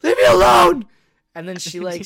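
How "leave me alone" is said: loud, high mock yell. Yelling at the cat to leave her alone. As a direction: shout it at the cat. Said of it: "It's like".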